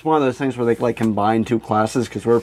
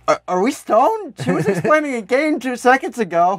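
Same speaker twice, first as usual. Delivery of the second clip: high incredulous disbelief; 11 semitones higher than usual. Real, not acted. Incredulous retelling.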